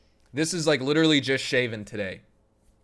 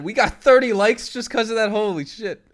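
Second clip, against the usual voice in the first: high, loud astonishment; amazed excitement; 10 semitones higher, 11 dB louder than usual. Real, not acted. Streamer amazed at thirty new likes, loud excitement. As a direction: blurt it out loudly, amazed.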